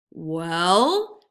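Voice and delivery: loud, clipped-short demand; impatient. A narrator's reading. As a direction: snap for an answer. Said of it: "cried the tiger impatiently".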